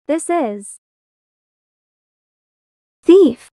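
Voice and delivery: loud startled shout. Acted. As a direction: yell it out in alarm. Shouting accusation at intruder.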